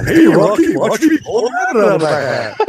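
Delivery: high, loud cartoon moose voice; Bullwinkle. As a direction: call out goofily like a cartoon moose. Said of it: "I can do those characters, Rocky and Bullwinkle".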